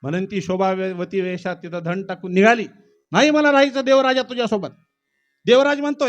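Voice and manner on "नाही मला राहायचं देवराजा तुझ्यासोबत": a touch louder, higher, fast refusal; courtesan refusing. Acted. Courtesan rejecting Devraj, a bit raised and quick. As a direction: brush him off quickly.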